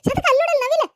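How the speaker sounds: high, loud thrilled call to look; calling Shantakka to see the peacock; excited pointing out. Acted.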